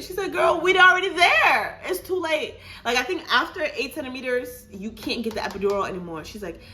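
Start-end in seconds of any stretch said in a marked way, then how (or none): 0.3-2.6 high, loud insisting; insistent nurse. Retold or imitated. Nurse telling the mother loudly it's too late. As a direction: call it out firmly.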